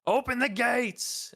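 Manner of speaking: high drawn-out call to those below; yelled command. Acted. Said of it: "yells down to the group behind".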